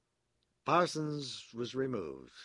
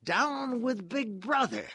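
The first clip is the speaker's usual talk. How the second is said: ordinary delivery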